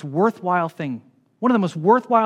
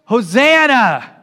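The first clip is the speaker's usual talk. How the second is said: loud, drawn-out crowd cheer; raised, stretched rallying shout; real loud; 4 semitones higher, 6 dB louder, much slower than usual. Shown as a demonstration. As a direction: call it out for everyone to join. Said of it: "say that real loud".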